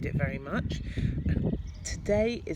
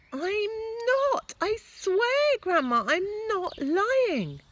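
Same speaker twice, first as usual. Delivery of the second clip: put-on pleading boy; 7 dB louder, slower than usual. George pleading to grandma.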